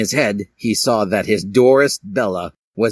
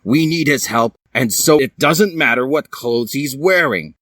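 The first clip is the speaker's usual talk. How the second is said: insisting; 5 semitones higher than usual. Acted. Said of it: "barged Edward".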